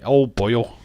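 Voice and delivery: put-on light Welsh boyo; Welsh accent. Shown as a demonstration. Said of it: "Welsh accent went straight".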